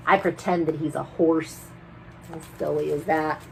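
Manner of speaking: ordinary delivery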